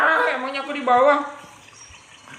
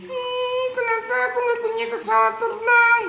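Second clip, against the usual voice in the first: high, sing-song sad complaint; sad whine; 10 semitones higher than usual. Acted. Car owner complains he has no plane, sad whine. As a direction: moan about the missing plane. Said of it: "Dia sedih".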